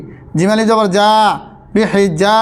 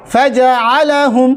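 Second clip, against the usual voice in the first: slow, higher melodic tilawat; Quranic recitation; 3 semitones higher, much slower than usual. Shown as a demonstration. Teacher reciting the full verse to students. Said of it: "তিন আলিফ পরিমাণ টান".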